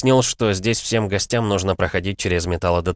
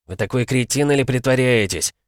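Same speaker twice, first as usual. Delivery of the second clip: mildly exasperated, higher-pitched challenge; higher, exasperated question; losing patience; 6 semitones higher than usual. A narrator's reading. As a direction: snap out of patience, without shouting. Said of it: "не выдержал Мортон".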